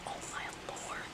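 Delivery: barely audible whisper to yourself; stunned whisper. Real, not acted. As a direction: whisper it under your breath. Stunned mid-haircut.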